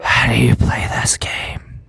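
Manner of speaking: breath-only seductive whisper; ASMR whisper. Acted. Elf asking guards about the dice game, ASMR whisper. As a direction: whisper it right into the mic, flirting. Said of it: "treating this like an A S M R video".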